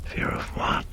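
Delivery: hushed whisper to himself; whispered thought. Acted. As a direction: whisper the question inward. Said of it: "Karras's inner thought".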